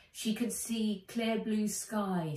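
ordinary delivery, nothing marked

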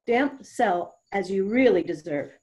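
plain reading voice

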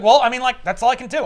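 a hammed-up helpless excuse; flustered apology. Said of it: "He's like".

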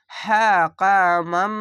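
হ্যাঁ with a puff of air, slowly; breathy ha. Shown as a demonstration. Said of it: "একটু বাতাস বের করে". (হ্যাঁ-তে একটু বাতাস বের করে ধীরে)

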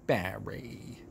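low, soft and lingering on Barry; loving sigh of a name. Shown as a demonstration. Says Barry's name with loving tenderness. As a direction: breathe Barry like a fond sigh. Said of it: "the way I love to say Barry's name".

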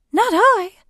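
high, loud startled refusal; startled kudu. A narrator's reading. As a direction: squeak it like a startled antelope. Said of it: "said the startled kudu".

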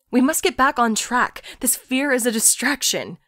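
a clipped, urgent order to get back on track; the tiger takes on Lori's voice, loud and firm; tiger with her voice. A narrator's reading. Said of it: "It had her voice, her exact voice".

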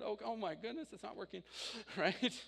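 usual speaking voice

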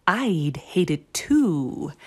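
slow, low-key, cunning drawl; sly fox. A narrator's reading. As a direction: coax the chicks slyly. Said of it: "he smiled slyly and said".

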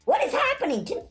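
high, incredulous squeal; exasperated protest. Real, not acted. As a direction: complain in a high, disbelieving voice. Chased again, protesting.

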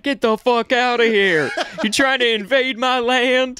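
high-pitched indignant castaway; hammed-up defence of your land; mock territorial outrage. Acted castaway chasing off rescue boats.

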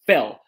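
"fill" with a short, lax i; English short i. Shown as a demonstration. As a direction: say "fill" with the relaxed short vowel. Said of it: "ou então da palavra encher".

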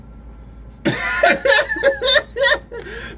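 shrieking giggle; high laughing fit. Real, not acted. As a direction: cracks up at her own glubbing.

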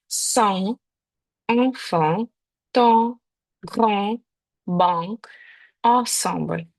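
words said with the nasal «ан» sound; French nasal an. Shown as a demonstration. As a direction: open the mouth and send «ан» into the nose. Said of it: "відчутно цей звук в таких словах". (слова з носовим «ан»)

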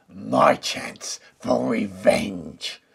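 slow, loud villainous wolf voice, dropping to a hiss on the race; wicked wolf plotting revenge; snarling wolf. A narrator's reading. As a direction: gloat over the pigs like a scheming wolf. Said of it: "snarled the wolf".

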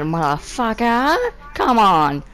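ordinary talk

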